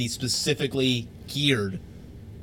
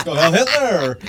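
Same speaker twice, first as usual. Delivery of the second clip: loud, higher-pitched mock chant; hammed-up shout, stretched on the last word; mock rallying shout; 4 semitones higher, 10 dB louder, slower than usual. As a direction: belt it out like a slogan. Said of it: "it goes completely different".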